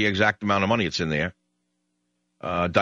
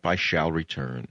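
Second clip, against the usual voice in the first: slow, soft and grave; solemn sign-off; 5 semitones lower, 5 dB quieter, slower than usual. Acted. Radio host closing the show, slow solemn farewell. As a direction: promise it like a general.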